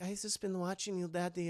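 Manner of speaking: soft, hushed Esther voice; imitated creepy seduction.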